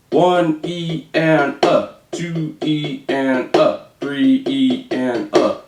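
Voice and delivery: slow, even One E and a; drawn-out counting for practice. Shown as a demonstration. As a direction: count it out slowly, one subcount at a time. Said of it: "how to count it with thirty-second notes".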